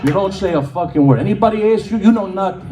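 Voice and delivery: a hint of a stern old Italian laying down the law; mild Italian accent, emphatic; Italian grandpa. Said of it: "He says".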